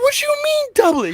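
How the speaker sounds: squeaky high disbelieving question, fast; can't believe the dare; incredulous. Retold or imitated. To friend daring him to double the bet, high disbelief.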